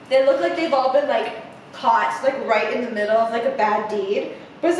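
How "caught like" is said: usual speaking voice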